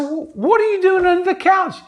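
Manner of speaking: high-pitched, incredulous retold question; acted astonishment. Therapist finds his client under the couch, acting astonished. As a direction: act baffled, voice jumping way up.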